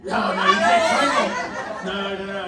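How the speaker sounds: mock-protest through laughter; laughing joke. Joking about splitting the kids.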